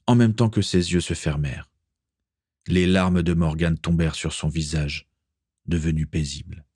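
plain reading voice

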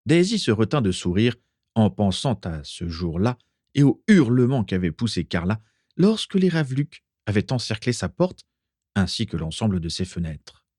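usual speaking voice

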